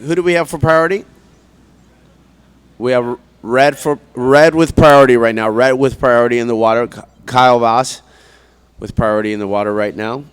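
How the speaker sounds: loud beach PA call; laid-back surfer announcer. Real, not acted. Live announcer calls priority.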